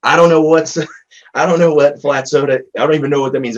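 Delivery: high, loud amazed burst through a laugh; reliving his own shocked laugh; excited disbelief. Retold or imitated. Therapist telling a colleague, high amazed laugh. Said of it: "I'm like".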